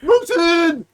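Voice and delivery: high, loud silly shout; high goofy shout; mock yell. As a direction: yell it like a cartoon yokel. Mocking a moose-hunting accent.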